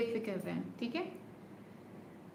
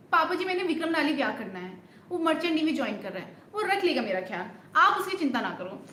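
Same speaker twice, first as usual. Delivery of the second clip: fast, louder pleading to father; rushed film dialogue; 3 semitones higher, 4 dB louder, faster than usual. Acted. Streamer auditioning the film scene, fast and pleading. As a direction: rush the heroine's plea. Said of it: "इतनी speed में बोल रहा है dialogue".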